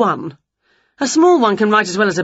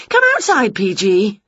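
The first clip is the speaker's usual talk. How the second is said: much higher, louder witch; eager witch; 4 semitones higher, 4 dB louder than usual. Acted. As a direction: ask brightly. Winnie calling.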